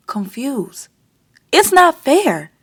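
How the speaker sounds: ordinary delivery, nothing marked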